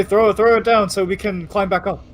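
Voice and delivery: calling out. Acted. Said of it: "if you yell to them".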